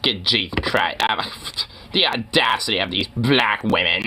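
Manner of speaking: low put-on scandalized voice; mocking, hammed-up disapproval; mock outrage. Mocking the heckler's supposed outrage.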